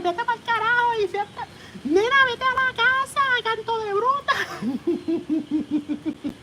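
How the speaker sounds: scolding caricature with a laugh at the end; mock Puerto Rican yelling. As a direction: play a fed-up local shooing someone home. Locals yelling at protester.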